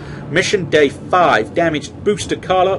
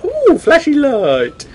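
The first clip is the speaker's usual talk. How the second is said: thought quote; 10 semitones higher, slower than usual. Retold or imitated. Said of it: "I thought".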